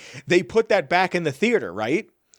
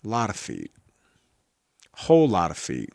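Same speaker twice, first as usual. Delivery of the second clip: deadpan repeat; 8 semitones lower than usual. Acted. Repeated joke, dropping lower.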